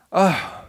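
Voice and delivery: sharp high groan of annoyance; exasperated ugh. Retold or imitated. Boy dreading the errand, high annoyed groan. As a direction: groan like a sulky kid. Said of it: "I was really thinking".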